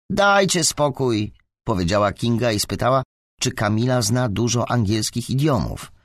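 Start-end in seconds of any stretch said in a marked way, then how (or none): 0.1-1.3 slow, higher-pitched plea to stop; exasperated appeal. A narrator's reading. Kinga breaks up the classmates' quarrel, exasperated appeal. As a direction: drag it out, fed up.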